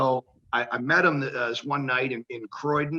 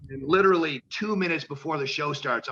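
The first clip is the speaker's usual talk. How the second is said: normal talking voice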